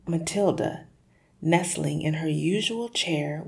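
usual speaking voice

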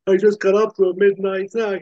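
sheepish father-in-law excuse, voiced up; father-in-law caught out. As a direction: play the startled dad making excuses. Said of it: "He's like".

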